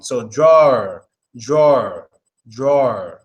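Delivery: "drawer" gliding through two vowel sounds; drawer as diphthong. Shown as a demonstration. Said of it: "There's two sounds in there".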